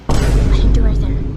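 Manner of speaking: startled gasp, then loud realization; sudden gasp. Real, not acted. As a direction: gasp as if spotting a mistake. Notices an unfinished bulletin board.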